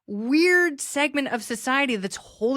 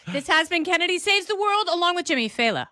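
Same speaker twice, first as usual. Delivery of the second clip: host sign-off; 6 semitones higher, faster than usual. Acted. Show sign-off.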